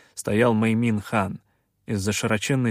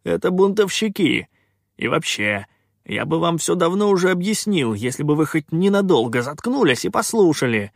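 raised, exasperated outburst; irritated; 7 semitones higher, 5 dB louder than usual. A narrator's reading. Pirate scolds the noisy villagers, irritated. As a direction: scold them impatiently and loudly. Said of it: "раздражённо сплюнул Калаан".

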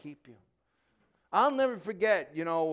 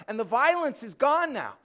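higher, louder, building excitement; loud, high, energetic testimony; fired-up preaching; 7 semitones higher, 9 dB louder than usual. Real, not acted. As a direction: preach it with rising fire. Preacher's lively climax.

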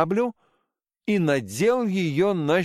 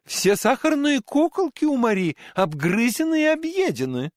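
high, louder exclaiming mother; 12 semitones higher, 5 dB louder than usual. A narrator's reading. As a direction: exclaim it in surprise. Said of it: "воскликнула мать наутро".

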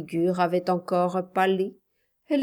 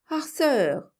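Arthur said much higher, strained; high register; 6 semitones higher than usual. A narrator's reading. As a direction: call Arthur in a singular high voice. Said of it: "répéta-t-elle sur un registre aigu".

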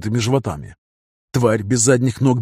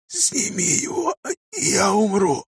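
much higher, softer, strained; hoarse plea; 9 semitones higher, 5 dB quieter, slower than usual. A narrator's reading. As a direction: rasp it, begging for death. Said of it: "прохрипел бедолага". (хриплая мольба)

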